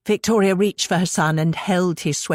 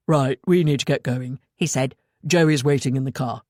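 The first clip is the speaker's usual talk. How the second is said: lightly voiced boy; 4 semitones lower than usual. A narrator's reading. Kit telling Victoria they must go, lightly lower. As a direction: slightly lower boy's voice.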